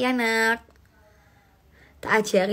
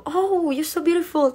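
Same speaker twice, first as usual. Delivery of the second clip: higher, slow, gushing imitation of a fan; lightly voiced admirer, stretched out and sweet; imitating the airport worker; 8 semitones higher, slower than usual. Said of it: "aku bilang gitu". (menirukan petugas bandara yang memuji, nada tinggi dan pelan)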